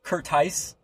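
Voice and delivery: "Curtice" ending like "ice"; misspelled-name pronunciation. Shown as a demonstration. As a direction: say "Curtice" as ker-TICE. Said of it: "I've gotten C E R T I C E".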